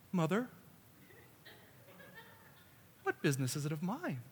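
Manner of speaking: hushed, reverent delivery; softened, gentle reading. Shown as a demonstration. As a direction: say it softly and carefully, nothing sharp. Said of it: "to say it like this".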